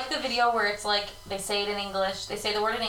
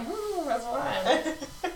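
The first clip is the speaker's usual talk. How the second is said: slow, forced calm; 2 semitones higher, 6 dB quieter, much slower than usual. Retold or imitated. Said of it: "I'm literally like".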